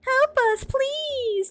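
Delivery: shrill plea. A narrator's reading. Tabby begging the passing man for help. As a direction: beg him frantically. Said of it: "pleaded shrilly".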